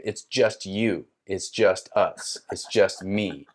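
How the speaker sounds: slow, evenly spaced pronouncements; deliberate revelation. Retold or imitated. Inner voice revealing there is no ayahuasca, deliberate. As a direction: lay out each short line slowly, falling at the end.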